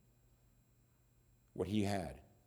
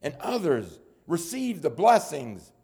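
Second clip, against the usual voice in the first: high, loud pulpit climax; forceful preaching; 9 semitones higher, 7 dB louder than usual. Acted. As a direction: press it home to the congregation.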